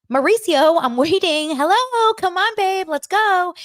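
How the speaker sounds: high, bouncy sing-song call to her husband; light playful nagging. Retold or imitated. Wife playfully calling her husband to hurry, high and sing-song. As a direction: tease him to hurry up, all sweetness. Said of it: "she would keep it light".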